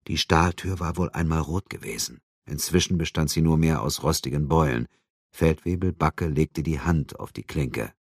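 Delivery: ordinary delivery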